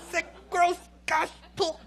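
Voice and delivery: high squeal of disgust; disgusted teen. Retold or imitated. Daughter hit by flies, squealing in disgust.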